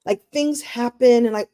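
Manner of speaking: ordinary delivery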